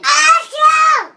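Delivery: loud mock sneeze; imitated sneeze. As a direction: copy dad's sneeze loudly. Child mimics the sneeze.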